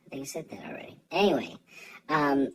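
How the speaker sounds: ordinary talk, nothing marked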